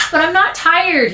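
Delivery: put-on high, drawn-out boyish protest; whining. Boy resisting his mother's nap order, whining. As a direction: drag out TIRED in a whine. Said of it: "Kermit whines".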